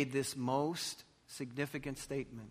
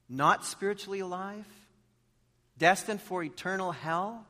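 rising, louder pulpit appeal; preaching at full force; 8 semitones higher, 5 dB louder than usual. Acted. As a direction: build the appeal to a peak. Sermon building to a peak.

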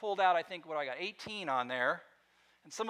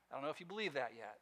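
ordinary talk, nothing marked

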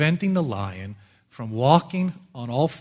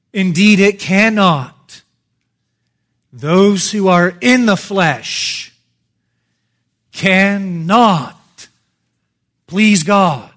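slow, loud, high preaching of the verse; forceful preaching; 4 semitones higher, 10 dB louder, slower than usual. Acted. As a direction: drive each word home from the pulpit. Preaching Paul's words at full force.

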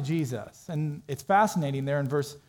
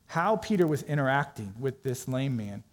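ordinary talk

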